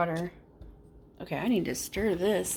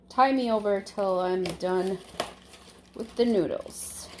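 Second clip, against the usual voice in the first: ordinary talk, nothing marked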